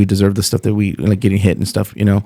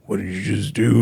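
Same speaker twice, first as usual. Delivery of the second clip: menacing big guy; 7 dB quieter than usual. Retold or imitated. Big guy in movie turning around.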